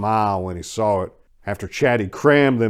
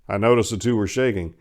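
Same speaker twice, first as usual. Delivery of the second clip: ordinary delivery, nothing marked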